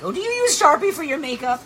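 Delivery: high-pitched, incredulous question; retold astonishment. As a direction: ask it in exaggerated disbelief.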